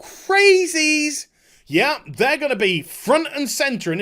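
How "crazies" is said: a higher, louder shout of the one word; mocking yell. Real, not acted. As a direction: bark it out in scorn. Yelling at the ad.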